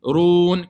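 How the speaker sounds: loud, held Roun; prolonged vowel. Shown as a demonstration. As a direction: sustain the OU of Roun on one note. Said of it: "combien de temps?".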